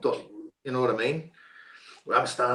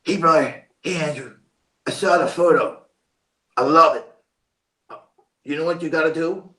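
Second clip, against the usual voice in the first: lightly voiced upbeat director, higher in short bursts; imitated director; 5 semitones higher, 6 dB louder than usual. Director greeting his chosen actor, lightly imitated enthusiasm. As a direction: imitate a keen director, stretching the praise. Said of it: "So down comes David Nutter, and he goes".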